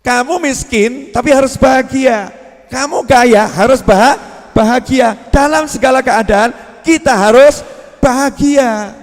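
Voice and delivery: loud, rising sermon delivery, leaning into every point; fervent preacher's declamation; full-force preaching. Acted. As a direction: preach it out to the whole hall.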